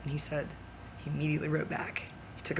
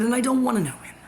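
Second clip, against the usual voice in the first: higher, louder quoted line; quoting Michael; 7 semitones higher, 6 dB louder, faster than usual. Retold or imitated. Quoting Michael, a lightly pitched-up voice. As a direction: toss off his words pitched up.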